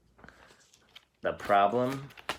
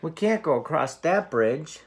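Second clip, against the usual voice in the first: higher, louder worried goat; frightened cry; 8 semitones higher, 6 dB louder than usual. Acted. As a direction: blurt it out in alarm as the nervous goat. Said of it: "cried Middle Billy Goat Gruff".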